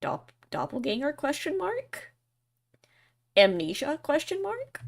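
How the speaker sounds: rising sharply on "question mark"; questioning guesses. Acted. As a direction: lift "question mark" high like a query.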